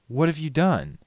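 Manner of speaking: horrified at the frozen world; shocked alarm. A narrator's reading. Styx in shock.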